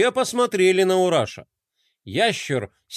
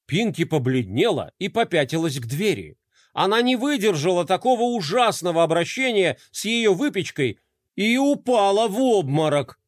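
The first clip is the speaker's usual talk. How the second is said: ordinary delivery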